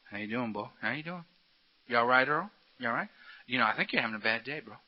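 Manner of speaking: low, soft, buddy-buddy inner tempter; the beast. Acted. The beast sweet-talking a man sweeping the street, low and soft. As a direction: sidle up quietly like a sly old pal. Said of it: "the beast going".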